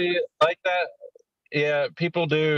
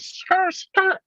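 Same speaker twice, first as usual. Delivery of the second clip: high, squealed skidding noise; imitated tyre screech. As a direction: squeal the word like skidding tyres. Imitates tyres screeching in sign-off.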